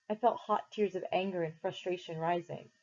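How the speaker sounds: ordinary delivery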